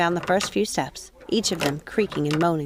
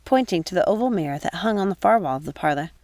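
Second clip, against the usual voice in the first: usual speaking voice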